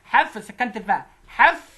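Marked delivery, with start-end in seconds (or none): 1.3-1.7 حف said higher and louder with the ف held still; modelled pronunciation. Shown as a demonstration. Teacher showing the pupil how حف is pronounced. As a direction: say حف with a clean stopped ف. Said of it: "سكنت الأول".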